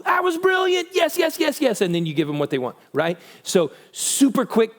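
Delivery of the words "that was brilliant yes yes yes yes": high, bright burst of praise; excited praise. Retold or imitated. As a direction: cheer them on like they just won.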